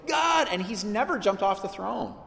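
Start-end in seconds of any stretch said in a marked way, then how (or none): 0.1-0.5 high sudden cry to God; exasperated cry. Retold or imitated. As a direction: blurt it out in frustration. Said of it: "make us go".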